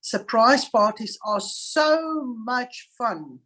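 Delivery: so leaned on, whole line slow; stress on so. Shown as a demonstration. Teacher gives a second example sentence, slow with stressed so. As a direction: stretch it out and lean on so. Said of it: "We can also use it and say".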